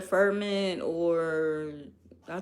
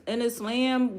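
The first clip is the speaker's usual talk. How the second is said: normal talking voice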